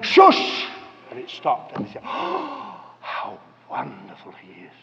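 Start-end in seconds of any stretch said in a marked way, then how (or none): 0.0-0.7 loud, forceful hushing; commanding shush. Acted. Jesus standing up to stop the storm, loud commanding shush. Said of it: "he stood up and said". 2.1-4.7 soft gasp sinking very low; hushed awe. Acted. Disciples after the storm stopped, hushed and awestruck.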